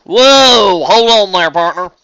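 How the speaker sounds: loud, high cowboy-style whoa; southern drawl. Acted. Farm pony halts her overexcited friend, loud drawl. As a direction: rein her in with a big drawl. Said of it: "the farm pony drawled".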